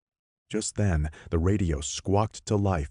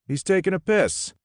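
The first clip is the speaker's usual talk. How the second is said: much higher voiced radio man; gruff radio guy; 6 dB louder than usual. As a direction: answer offhand as another man.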